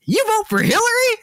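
high, squeaky mock Southern voice; old Southern man. Podcaster mimicking a Southern stranger's pointed question, mock high voice. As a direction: pitch way up like a suspicious old man. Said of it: "and then he goes".

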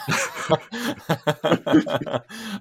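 high, breathless joyful laugh; laughing player. Retold or imitated. Draft pick laughing on the phone, joyful. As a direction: laugh in disbelief at the news. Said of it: "And he goes".

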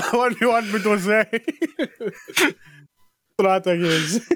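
put-on comic accented impersonation, breaking into laughter; Eki. Co-host imitates to amuse the host, accented impression. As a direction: put on the accent, barely holding back laughs. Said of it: "talk like Eki".

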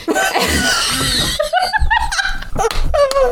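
long high-pitched laughing fit; real helpless laughter; laughing hard. Real, not acted. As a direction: crack up completely. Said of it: "I laughed so hard at that".

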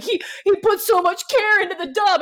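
high, loud, hammed-up weepy wail; mock sobbing. Fan moved by Anthony's dub work, mock sobbing. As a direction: wail it as if overcome with tears. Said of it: "and I'm sobbing".

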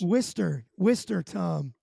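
"Worcester" said as WISS-ter; correcting pronunciation. Real, not acted. Co-host corrects Tom's town name, pronounced WISS-ter. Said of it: "it's pronounced Worcester".

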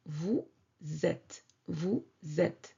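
"Vous êtes" linked, a z sound joining "vous" to "êtes", said softly; liaison shown. Shown as a demonstration. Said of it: "la liaison".

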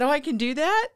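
pleasantly surprised at the news; delighted surprise. Retold or imitated. Told she can wipe the ashes off, delighted surprise.

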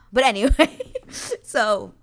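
high, loud laughing through the words. Real, not acted. Podcaster laughing off her own heated rant, laughing. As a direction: laugh it off and move on.

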